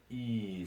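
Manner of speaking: "E" held as a long vowel; stretched, sustained "E" sound. Shown as a demonstration. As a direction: draw out the E slowly. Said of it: "like a long E sort of sound".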